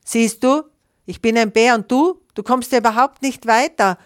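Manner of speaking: high, loud, superior taunting; condescending. A narrator's reading. Bear up the tree taunts the little goose, condescending. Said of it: "von oben herab".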